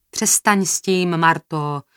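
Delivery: slow, tired plea; weary. A narrator's reading. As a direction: drag it out wearily, still firm in volume. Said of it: "řekl Tching unaveně".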